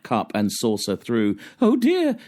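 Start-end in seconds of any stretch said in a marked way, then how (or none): 1.6-2.2 loud, very high wail of dismay; high-pitched hammed-up alarm; crying out. A narrator's reading. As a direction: cry out in dismay as a storybook wife. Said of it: "she cried".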